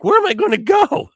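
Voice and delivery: high, loud incredulous comeback; exasperated retort. Retold or imitated. Kid stranded on a truck roof answering the pilot, exasperated retort.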